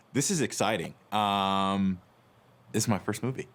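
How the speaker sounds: ordinary talk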